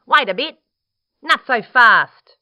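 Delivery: high, loud, indignant echidna; bristling. Acted. Echidna stopping Dingo, loud and bristling. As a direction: call a halt loudly, bristling. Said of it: "he bristled".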